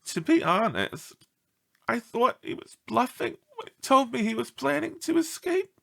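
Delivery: worried lady lightly voiced; upset owner. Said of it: "said a visibly upset Gertrude R. LaRue".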